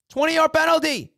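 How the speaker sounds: frustrated fan shouting the number back; outraged exclamation. Real, not acted. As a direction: blurt it out in disbelief. Outraged repeat of penalty.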